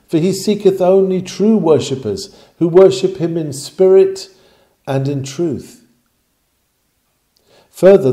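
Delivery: ordinary delivery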